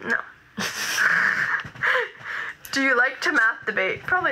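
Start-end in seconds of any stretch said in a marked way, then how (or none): 0.6-2.7 high, breathy laugh; a high burst of airy giggling; laughter. Real, not acted. Laughing at a viewer comment.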